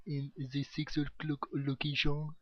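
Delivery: a light mock French accent. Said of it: "That was a bit of my French accent".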